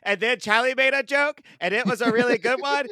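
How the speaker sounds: excitable, high-pitched mock recap; high, childlike rambling report to the wife; imitating husband. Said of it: "Yeah, he's like".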